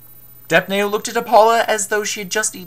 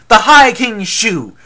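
"High King Shu" slow, loud and raised; emphatic restatement; 5 semitones higher, 9 dB louder, slower than usual. Acted. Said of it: "Apollo restated".

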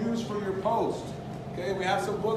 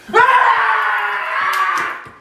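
loud high yelp of fright; startled shout; 13 semitones higher, 10 dB louder than usual. Real, not acted. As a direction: yell in surprise. Jump scare.

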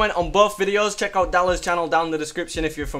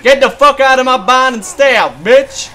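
loud, raised shout to clear out; angry-style yell; 4 semitones higher, 9 dB louder than usual. Real, not acted. Player driving zombies from his barn, loud yell. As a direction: yell them off your property.

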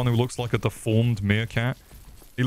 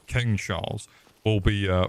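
normal talking voice

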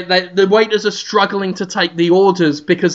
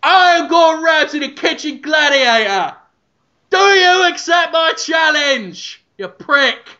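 gladiator shout; 8 semitones higher than usual. Acted. Ramsay challenging owner at door.

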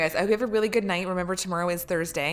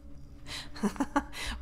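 breathy laugh; 8 semitones higher, 6 dB quieter than usual. Real, not acted.

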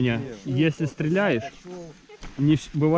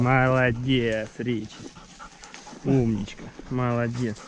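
slow, low dog praise; warm praise; 4 semitones lower, 4 dB quieter, slower than usual. Real, not acted. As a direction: reward the dog gently. Praising dog Rich slowly. (ласковая похвала)

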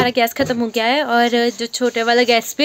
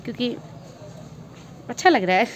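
talking very softly; 6 dB quieter than usual. Real, not acted. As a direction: keep it soft and shy, barely above a murmur. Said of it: "मैं बहुत धीरे बात कर रही हूँ ना".

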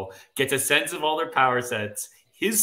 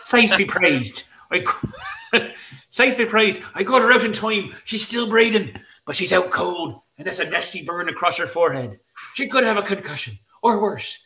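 higher-pitched, hammed-up Irish brogue; 5 semitones higher than usual. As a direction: read it out in your best Irish brogue. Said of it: "in your best Irish brogue".

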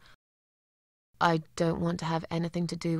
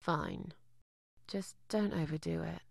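low, very soft warning; grudging aside; 2 semitones lower, 12 dB quieter than usual. Acted. Landlady cautioning her lodger before the interview, grudging and hushed. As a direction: give in under your breath.

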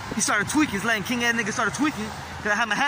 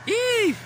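high squeal; 14 semitones higher, 4 dB louder than usual. Acted. Squeal after laugh.

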